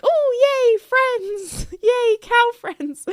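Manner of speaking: very high, squealing delight at the cows; excited squeal. Retold or imitated. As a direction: squeal it like a thrilled little kid. Excited greeting to friendly cows.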